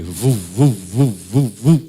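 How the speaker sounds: loud booming heartbeat sounds into the mic; heartbeat imitation. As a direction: thump out the baby's heartbeat, loud and low. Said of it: "ouvir o coração do bebê".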